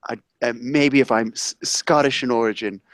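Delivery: low, hesitant chef impression; Gordon Ramsay. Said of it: "It's Gordon Ramsay".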